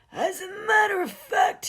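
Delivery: high, slow, loud groaning ghost; ghostly groan. Acted. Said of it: "he groaned in his ghostly manner".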